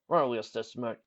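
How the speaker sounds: plain reading voice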